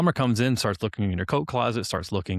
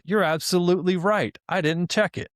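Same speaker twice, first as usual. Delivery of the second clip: imitating an eager, overly agreeable chatbot, pitched higher; chirpy fake-apologetic assistant; sycophantic AI; 8 semitones higher than usual. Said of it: "it's always saying".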